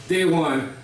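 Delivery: ordinary talk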